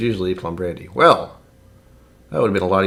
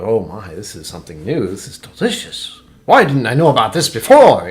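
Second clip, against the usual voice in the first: hushed wonder building to a loud outburst; amazed discovery; 7 dB louder than usual. Acted. Newcomer tasting good whiskey for the first time, amazement building to an outcry. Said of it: "that inhalation of".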